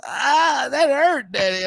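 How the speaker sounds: put-on high, crying little-boy whine; hurt kid whining. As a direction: whimper it like a child in pain. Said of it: "And he goes".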